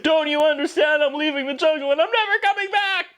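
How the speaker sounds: mock child proclamation. Boy proclaims he is leaving the jungle, high mock drama. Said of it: "as he turns and proclaims".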